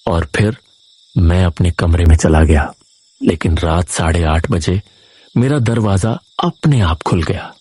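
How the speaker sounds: usual speaking voice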